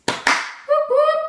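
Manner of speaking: very high double whoop; playful high-pitched whoop-whoop; celebratory whoop. Pretend. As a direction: squeal a little cheer, rising at the end. Celebrates being noticed by a YouTuber.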